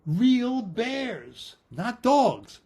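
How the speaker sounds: dramatic, drawn-out storyteller reveal; building excitement. A narrator's reading. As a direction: announce the bears like a big surprise. Bears fall from the sky.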